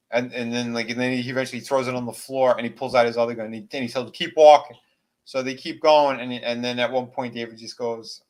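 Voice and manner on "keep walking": loud, hard command; gunpoint order. Retold or imitated. Deputy marching them off at gunpoint, loud command.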